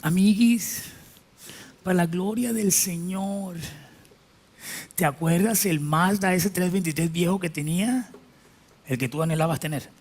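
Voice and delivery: a show-off friend gloating, lightly voiced; boastful friend. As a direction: brag like a smug rich friend.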